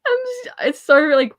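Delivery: high, loud, laughing while talking; words spill out through real laughter; giggling self-mockery. Real, not acted. Laughing at herself.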